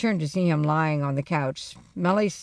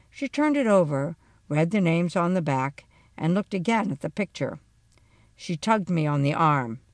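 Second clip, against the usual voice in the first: plain reading voice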